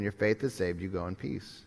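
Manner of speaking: soft gentle Jesus, hushed at the end; gentle and tender. Acted. Jesus speaks to the woman.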